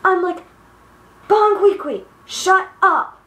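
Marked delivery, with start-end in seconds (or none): 1.3-3.2 higher, louder acted-out put-down; mock-fed-up, pitched-up retort; exasperated retort. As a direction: quote yourself telling a teammate off. Acted retort to ranting teammate.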